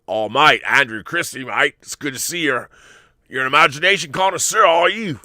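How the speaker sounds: hammed-up Australian accent, rising in pitch; Aussie accent. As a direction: put on a jokey, exaggerated Aussie voice.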